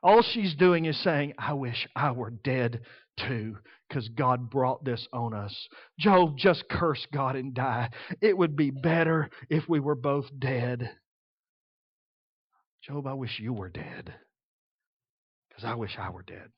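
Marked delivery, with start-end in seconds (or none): 1.4-11.0 low, slow, hollow grief; despairing. Retold or imitated. Mourning wife wishing she were dead, slow and low. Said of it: "In her despair and mourning". 12.8-16.5 very soft, low, broken; whispered despair. Retold or imitated. Grieving wife murmuring to Job, hushed despair. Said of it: "In her despair and mourning".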